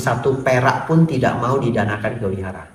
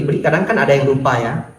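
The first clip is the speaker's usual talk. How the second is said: ordinary talk, nothing marked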